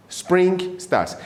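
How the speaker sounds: ordinary talk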